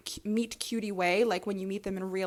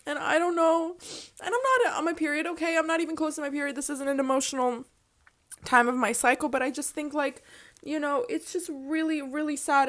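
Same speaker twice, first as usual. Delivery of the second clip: higher, wavering tearful talk; still teary; 7 semitones higher than usual. Real, not acted. Explaining why she's crying, still teary. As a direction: keep the tears in the voice. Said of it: "why am I crying".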